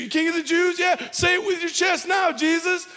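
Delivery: high, loud jeering; shouted high sneer; mocking taunt. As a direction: taunt him to his face. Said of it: "Mocking him".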